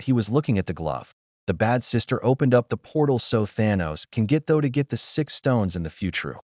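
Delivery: usual speaking voice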